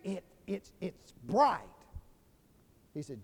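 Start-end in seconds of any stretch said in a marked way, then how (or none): 0.0-1.6 slow, stumbling attempt to explain; hesitant, drawn-out description; halting search for words. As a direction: grope for the word, then land on it. Struggling to describe sunset to blind friend.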